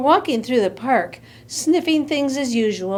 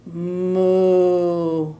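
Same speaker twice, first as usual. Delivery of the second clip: long, low moo; drawn-out cow call; 6 semitones lower than usual. Acted. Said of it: "And he went".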